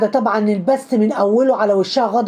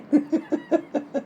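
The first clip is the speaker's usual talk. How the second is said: soft chuckle; quiet, bubbling laugh to yourself; 4 semitones higher, 8 dB quieter than usual. Real, not acted. Narrator laughs at his own commentary.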